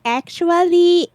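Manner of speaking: high, slow, know-it-all "actually"; snooty correction. Retold or imitated. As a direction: drawl the "actually" like a pedant. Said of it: "grammar Nazism".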